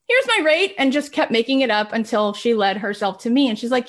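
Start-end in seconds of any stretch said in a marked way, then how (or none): 0.1-0.8 high, loud and chirpy; mock confident rate. [name] naming her rate to the woman, high and breezy. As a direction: toss out a made-up price like it's obvious.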